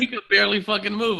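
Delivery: loud, high, laughing exclamation; amused, laughing loudly. Real, not acted. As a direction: crack up at the idea. Laughing at the dad mix-up.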